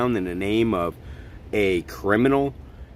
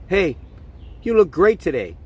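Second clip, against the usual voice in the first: a hint of a chirpy psychiatrist, higher; lightly voiced fawning doctor; mocking psychiatrist; 7 semitones higher than usual. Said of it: "The psychiatrist says".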